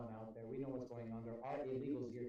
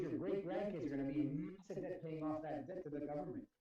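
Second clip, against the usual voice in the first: ordinary delivery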